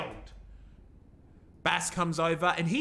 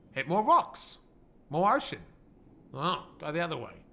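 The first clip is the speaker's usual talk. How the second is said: normal talking voice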